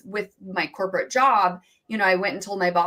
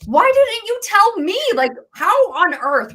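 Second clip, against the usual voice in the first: high, loud, slow cry of disbelief; as if the news just knocked you over; shocked disbelief; 11 semitones higher, 7 dB louder than usual. Retold or imitated. Retelling mom's surprise news.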